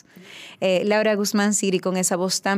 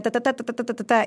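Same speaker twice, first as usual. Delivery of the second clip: rapid run of "ta" syllables; imitated piano playing; 5 semitones higher, faster than usual. Pianist launching into an unfamiliar score, rapid "ta-ta" imitation. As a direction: rattle off the notes like a pianist. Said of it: "él arranca".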